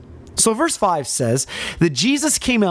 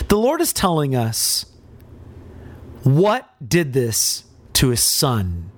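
ordinary delivery

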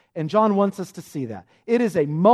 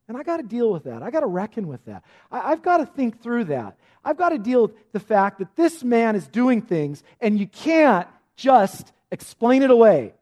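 building from musing to loud, high insistence; insistent reckoning; 5 semitones higher, 5 dB louder than usual. Acted. Nicodemus reasoning with himself about Jesus, insistent and building. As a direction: work yourself up as you argue it through. Acted thoughts of Nicodemus, wrestling.

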